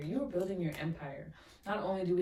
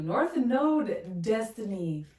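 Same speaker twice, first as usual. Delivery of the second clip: north node blurted higher and louder; excited exclaim; 4 semitones higher, 6 dB louder, much slower than usual. Real, not acted. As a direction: greet the card with delight. Excited at next card.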